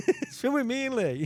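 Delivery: high laughing repeat. Real, not acted. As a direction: laugh through the line.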